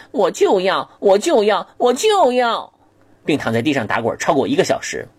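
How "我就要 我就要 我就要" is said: put-on higher and higher, a kid insisting; stubborn child tantrum. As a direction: demand it like a spoiled brat. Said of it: "态度强硬地说".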